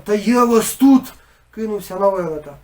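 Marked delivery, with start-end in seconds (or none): 0.0-1.1 loud, slow furious threat; angry yell. A narrator's reading. Father threatening the giant, loud angry yell. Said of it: "закричав".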